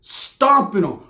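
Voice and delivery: ordinary delivery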